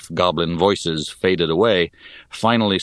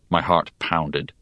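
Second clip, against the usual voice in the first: ordinary delivery, nothing marked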